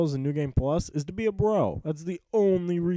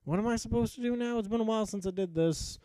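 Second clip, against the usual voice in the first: confused; 5 semitones higher than usual. Retold or imitated. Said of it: "I was kinda like".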